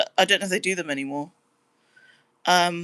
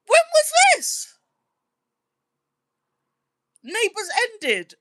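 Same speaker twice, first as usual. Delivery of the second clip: much higher, slow, stunned "When was this? Neighbours ended?"; shocked disbelief; 3 dB louder, slower than usual. Real, not acted. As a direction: gasp at news you can't believe. Learns Neighbours has ended.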